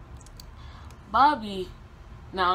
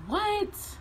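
stunned disbelief. Retold or imitated. Told she is hostile.

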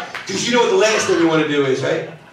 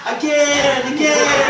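high, insistent again again; mock little kid begging for more; toddler demand; 7 semitones higher, slower than usual. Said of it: "'cause she said".

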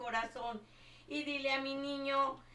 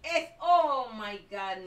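startled cry; 11 dB louder, slower than usual. Real, not acted. Gets shot in the game.

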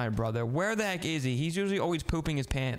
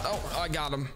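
ordinary talk, nothing marked

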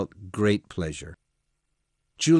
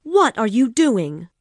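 loud, high outraged question; angry demand; 13 semitones higher, 8 dB louder than usual. Acted. Woman confronting the intruder at her window, angry. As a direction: throw the question at him, angry. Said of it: "she was very angry".

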